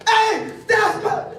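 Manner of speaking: high, slow, loud bold start; mock confident shout. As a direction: start like you own it, loudly.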